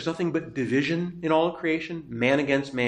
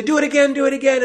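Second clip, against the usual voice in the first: high excited chant of do it again; cheering crowd; 12 semitones higher, 5 dB louder, faster than usual. Retold or imitated. Circus crowd cheers the tightrope walker, high and eager. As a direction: egg him on like a circus crowd. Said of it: "and they say".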